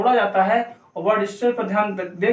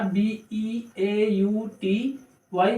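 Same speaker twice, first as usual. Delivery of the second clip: slow letters, a little softer; spelling out; 5 dB quieter, much slower than usual. Shown as a demonstration. Teacher spelling beauty for learners, slow letters. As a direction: dictate it letter by letter, unhurried.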